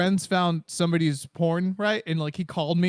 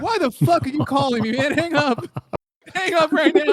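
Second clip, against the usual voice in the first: mock yell; 9 semitones higher, 4 dB louder than usual. Yelling at friend to hang up.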